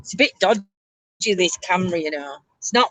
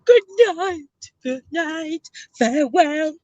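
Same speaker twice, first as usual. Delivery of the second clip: theatrical farewell; 9 semitones higher, slower than usual. Pretend. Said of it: "come on camera and say".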